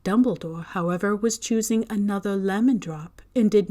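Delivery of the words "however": ordinary delivery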